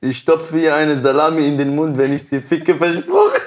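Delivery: reading through giggles, rising high; stifled giggles. Real, not acted. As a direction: try not to crack up. Reading crude comment while laughing.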